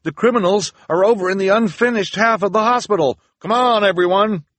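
high, loud excited call; loud, high-pitched rallying voice; bearded volunteer. A narrator's reading. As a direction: call out to the crowd in alarm. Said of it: "the voice of the bearded volunteer".

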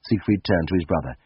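ordinary delivery

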